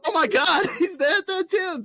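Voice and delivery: high mock-panicked cry; alarmed crowd voice. Wedding guests spot Lancelot, high mock alarm. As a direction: squeal it like a shocked guest.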